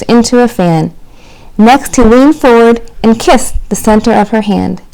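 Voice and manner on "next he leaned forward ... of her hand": ordinary delivery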